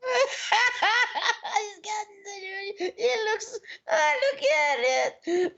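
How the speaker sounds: giggling high through the words; breaking up with laughter; laughing. Real, not acted. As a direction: laugh while you talk. Laughing at photo.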